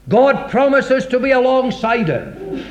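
ordinary talk, nothing marked

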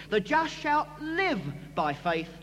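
"live" lifted with a rise; stress on LIVE. Shown as a demonstration. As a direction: lift the word "live" above the rest. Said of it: "the emphasis is upon the word live".

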